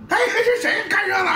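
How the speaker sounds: high, laughing question; amused, laughing disbelief. Real, not acted. Laughing at gawkers.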